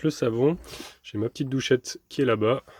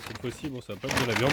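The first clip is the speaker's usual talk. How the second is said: ordinary delivery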